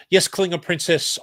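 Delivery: ordinary talk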